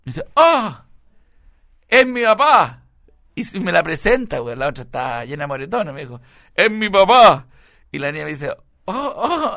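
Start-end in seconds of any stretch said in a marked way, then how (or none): 0.4-2.8 high, loud startled cry; asombro imitado. Retold or imitated. Retold son startled to see dad. 6.6-7.5 high, loud mock announcement; asombro imitado. Son introduces dad, retold. 8.9-9.5 high-pitched mock gasp of "oh"; sorpresa imitada. Girl reacts to meeting dad, retold.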